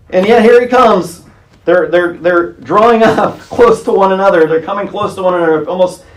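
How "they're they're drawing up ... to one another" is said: ordinary delivery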